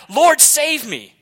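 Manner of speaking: high, loud, slow cry for help; desperate cry. Acted. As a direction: cry out as you sink. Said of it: "he shouts out in what is a beautiful cry".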